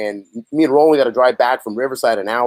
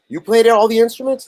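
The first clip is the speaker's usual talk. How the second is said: much higher, rising incredulous question; retold amazement; 9 semitones higher, 3 dB louder than usual. Producer learning one man played everything, retold amazement. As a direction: act out someone astonished by the answer.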